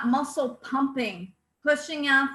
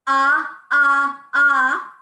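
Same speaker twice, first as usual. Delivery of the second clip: three loud punched ahs from the belly; loud staccato ah; 7 dB louder, much slower than usual. Shown as a demonstration. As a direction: pump each ah out loud and equal. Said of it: "say number four".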